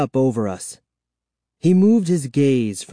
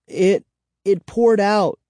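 stammering; 8 semitones higher, 3 dB louder than usual. A narrator's reading. Frightened child stammers.

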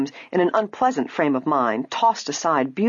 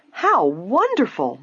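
slow, higher, swooping delight; delighted exclamation; 6 semitones higher, slower than usual. A narrator's reading. Nancy hears of the price cut, delighted exclamation. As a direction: exclaim with happy surprise. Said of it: "Nancy exclaimed".

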